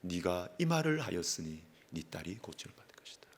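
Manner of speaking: hushed, voiceless whisper of Jesus's reply; whispered words of the Lord. Retold or imitated. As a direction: breathe the Lord's promise in a whisper. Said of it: "정직하게 딱 말하니까 주님이".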